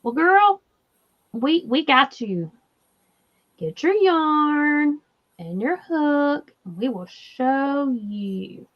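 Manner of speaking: normal talking voice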